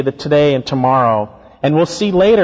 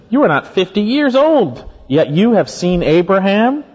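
high-pitched disbelief, slowing on the second half; incredulous challenge; 5 semitones higher than usual. Retold or imitated. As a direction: challenge him with a raised, questioning voice.

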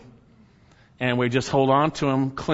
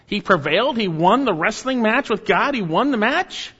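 much higher "Wait a second. He prevailed?" with rising questions; mock disbelief; 8 semitones higher, 3 dB louder than usual. Scene: preacher voicing a doubting listener, incredulous. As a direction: play the astonished listener. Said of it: "You say".

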